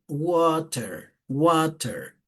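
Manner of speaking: American "water" with an A vowel and final R; American pronunciation. Shown as a demonstration. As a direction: say "water" the American way, "WAH-ter". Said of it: "En cambio, acá el otro dice".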